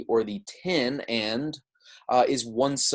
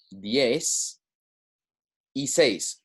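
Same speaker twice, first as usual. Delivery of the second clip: "diez", pause, "y seis"; broken into parts; 2 semitones higher, much slower than usual. Shown as a demonstration. Teacher showing how sixteen is built, in separated parts. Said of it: "it's almost like you're saying ten".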